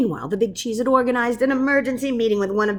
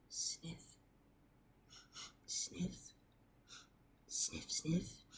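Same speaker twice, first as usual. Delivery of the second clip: creature sniffing; 3 semitones lower, 22 dB quieter, much slower than usual. Acted. Monster sniffing from the crater.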